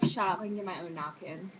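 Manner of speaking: ordinary talk, nothing marked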